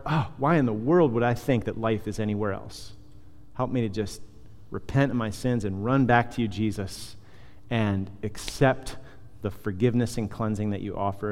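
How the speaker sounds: soft, inward confession to Jesus; quiet prayer. Acted. Pastor modelling a repentant prayer for the congregation, softly. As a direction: pray it under your breath, humbly.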